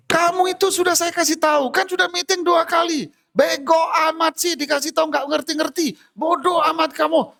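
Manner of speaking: boss scolding. Retold or imitated. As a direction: tear into the worker like a furious boss.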